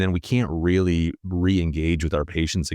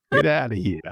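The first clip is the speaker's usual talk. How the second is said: mock Jersey accent; 5 semitones higher than usual. Podcast host teasing co-host's slip, mock Jersey accent. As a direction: mimic a New York or Jersey accent. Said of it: "I don't know what that accent was".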